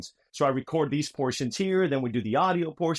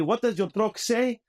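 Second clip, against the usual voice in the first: a hint of Slojamistani accent, slow and higher; 8 semitones higher, slower than usual. Guy asks about the truck, in a light Slojamistani accent. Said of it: "you have to have a Slojamistani accent".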